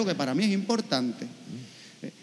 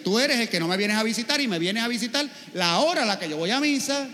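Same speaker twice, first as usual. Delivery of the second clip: usual speaking voice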